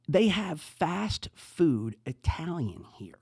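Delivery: slow, hushed, scandalized whisper; mock confession. Real, not acted. Podcaster confiding to Alex in a slow whisper. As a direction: whisper it like a shocking secret.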